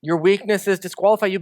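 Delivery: normal talking voice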